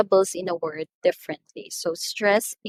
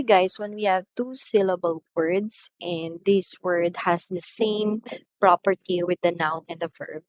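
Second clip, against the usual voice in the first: ordinary talk, nothing marked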